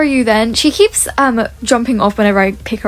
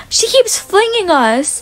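shocked gasp; 8 semitones higher, slower than usual. Real, not acted. Getting flung by a hacker.

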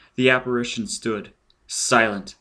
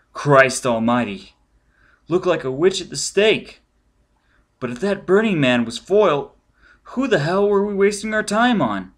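agitated; 8 semitones higher, 7 dB louder than usual. Acted. Agent rattled by the sight.

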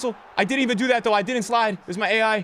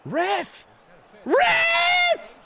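furious protest at the referee; angry shouting; 8 semitones higher, slower than usual. Real, not acted. Yelling at the ref in game.

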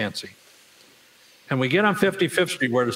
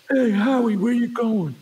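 high-pitched mock greeting; acted neighborly hail; 9 semitones higher, 3 dB louder than usual. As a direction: call out high, imitating a nosy neighbor. Neighbor hails him in story.

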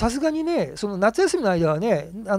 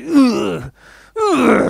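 louder, high growling "うー"; loud eerie groan; 7 semitones higher, 9 dB louder, much slower than usual. Retold or imitated. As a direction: groan like a voice not a child's. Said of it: "って言うんですって".

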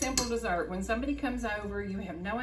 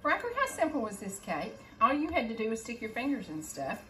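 normal talking voice

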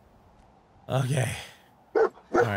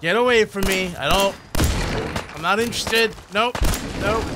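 panicked nope; 6 semitones higher, 5 dB louder than usual. Real, not acted. A bear attacks him.

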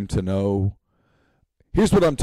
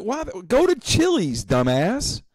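higher, scornful server voice; mock rude server; 9 semitones higher, slower than usual. Server dismissing grilled chicken order, scornful. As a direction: brush the customer off with scorn. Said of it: "he looks at you like".

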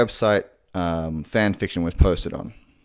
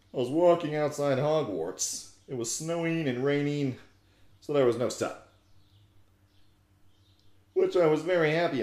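put-on mopey teen goth narrating her own fanfic; goth girl voice; 4 semitones higher, 3 dB quieter than usual. Comedian reads My Immortal aloud as Ebony, mock-goth. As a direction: read it as a self-serious edgy teen girl.

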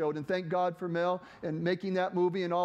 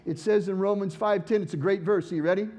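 usual speaking voice

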